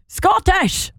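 high-pitched Scottish exclamation; Scottish accent. Retold or imitated. Reading out a listener's comment in a Scottish accent. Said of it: "I read that in a Scottish accent".

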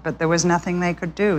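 usual speaking voice

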